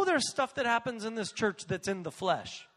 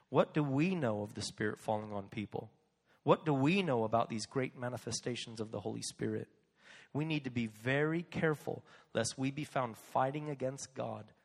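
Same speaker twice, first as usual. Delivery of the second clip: quieter, lower reading of a quoted text; soft, lower quoted reading; reading a quote; 7 semitones lower, 5 dB quieter than usual. Acted. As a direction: read the passage out, measured and subdued. Reads quoted passage aloud.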